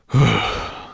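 heavy exhaled sigh; weary sigh. A narrator's reading. Weary Firedrake after the climb, sighing. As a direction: let out a long tired breath.

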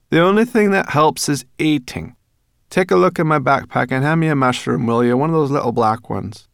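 loud, high brownie voice; Sorrel the brownie. A narrator's reading. Sorrel asking Ben for a mushroom, loud and bossy. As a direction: order a snack at full volume.

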